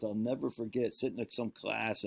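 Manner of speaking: ordinary talk, nothing marked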